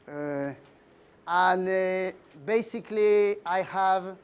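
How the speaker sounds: ordinary talk, nothing marked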